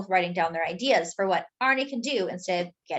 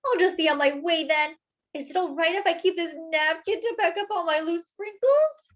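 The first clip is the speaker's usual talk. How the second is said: fighting back tears; 8 semitones higher than usual. A narrator's reading. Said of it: "fighting back tears".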